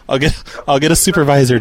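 usual speaking voice

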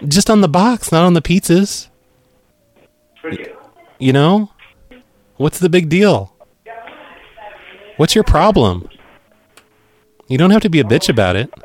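high-pitched, exasperated complaining; indignant. Acted. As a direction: act offended and defensive. Prank caller arguing with customer.

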